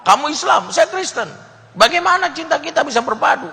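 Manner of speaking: high, loud falsetto, firm refusal; firm woman's voice. Acted. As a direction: say no like a stern girl. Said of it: "dengan tegas bilang".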